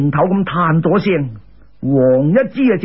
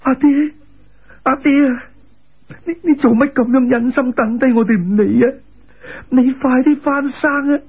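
put-on higher, wailing child voice; a son calling out to his father, tearfully voiced and higher; crying child; 6 semitones higher than usual. A narrator's reading. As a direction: wail for a dead father as a young son. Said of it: "亦喊住咁大叫".

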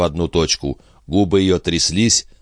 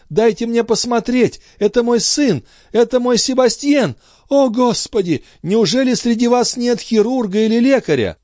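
very high, pleading calls; mother pleading with the crowd, very high; desperate pleading. Acted. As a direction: beg the crowd to let her through. Mother pushing through crowd.

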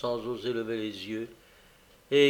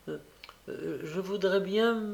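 put-on higher, hesitant child's voice; timid stammer; 6 semitones higher than usual. A narrator's reading. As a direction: start haltingly, afraid to ask. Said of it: "balbutia l'enfant". (voix d'enfant timide qui balbutie)

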